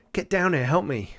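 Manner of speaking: high-pitched call for help; urgent plea. Acted. Father to companions by the crevasse, urgent plea. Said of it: "He's like".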